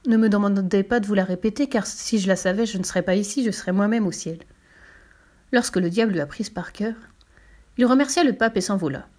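usual speaking voice

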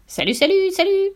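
high parrot voice climbing at the end; high, rising bird greeting; parrot call. A narrator's reading. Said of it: "lui lança le perroquet".

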